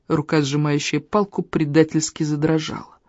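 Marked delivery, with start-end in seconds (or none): none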